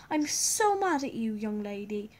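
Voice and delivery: loud exasperated mother; angry scolding mom. Acted.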